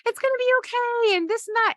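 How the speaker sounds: squeaky forced cheer of the movie Joy; Joy brushing off sadness with chirpy cheer. Retold or imitated. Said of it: "Joy keeps trying to cheer her up".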